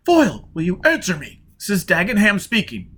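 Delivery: a rival calling on your band; Dagenham hailing. Acted.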